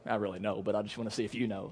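low, hushed and a bit smug; hushed, teasing know-it-all aside; mock Jesus aside. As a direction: toss it off under your breath like a know-it-all. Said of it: "You think he's just like".

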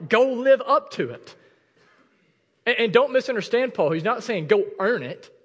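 loud, high-pitched, driving sermon delivery; full-force preaching. Real, not acted. Pastor challenging people who shrug off sin, full-force preaching.

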